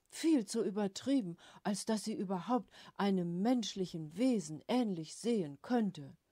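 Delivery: loud indignant tirade; loud rant. A narrator's reading. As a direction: keep raging at the caricature. Father keeps ranting, loud. (laute, empörte Tirade)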